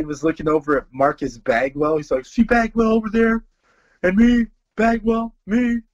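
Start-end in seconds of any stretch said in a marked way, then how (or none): 2.3-5.8 hammed-up Stone Cold ET imitation. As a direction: imitate the impersonator's growl. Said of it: "and he goes".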